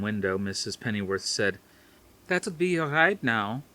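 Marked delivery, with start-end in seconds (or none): 2.3-3.6 put-on higher, gently Dutch-accented older woman; a hint of a Dutch grandmother; light Dutch accent. Elderly Dutch babysitter speaks.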